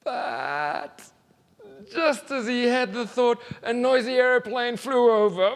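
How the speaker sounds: high, loud alarmed narration. A narrator's reading. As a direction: cry it out in alarm. Sudden interruption.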